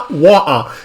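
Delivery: "Water" with a glottal stop for T, no R; mimicked British glottal T. Viewer joking about UK speech, "Water" with T swallowed. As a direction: drop the T in "Water", mock British. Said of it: "In the U K".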